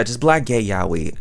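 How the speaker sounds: usual speaking voice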